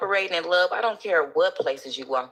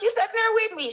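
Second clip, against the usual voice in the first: quoting a friend. Retold or imitated. Quoting the woman kindly.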